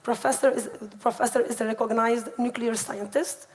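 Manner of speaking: normal talking voice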